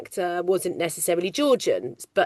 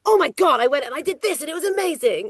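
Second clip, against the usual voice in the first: over-the-top excitement; 7 semitones higher, faster than usual. Shown as a demonstration. Actor showing what background chatter is not, overexcited. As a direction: gush as if telling amazing news. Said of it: "conversation is normally quite normal. It's not about".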